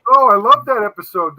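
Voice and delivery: loud, keen fan reaction; excited interjection. Real, not acted. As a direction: burst in with delighted enthusiasm. Gleeful interjection about a favourite episode.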